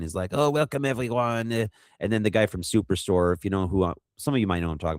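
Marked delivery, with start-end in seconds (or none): 0.3-1.5 higher-pitched mock French greeting; French accent. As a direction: imitate a French host welcoming guests. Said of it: "coming up with the French accent".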